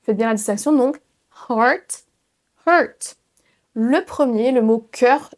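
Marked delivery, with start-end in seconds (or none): 1.5-2.0 "heart" as an H plus "art"; heart, open vowel. Shown as a demonstration. Teacher contrasting two words, "heart" with open AH. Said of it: "comme si vous disiez heart". 2.7-3.1 "hurt" as H plus a French "eu", higher; hurt, central vowel. Shown as a demonstration. Teacher contrasting two words, "hurt" with central UR. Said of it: "un son H plus un son E".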